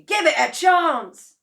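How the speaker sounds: high, loud insistent plea; impatient father. A narrator's reading. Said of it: "cried Mister Cranky".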